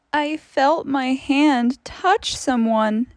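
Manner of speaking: ordinary delivery, nothing marked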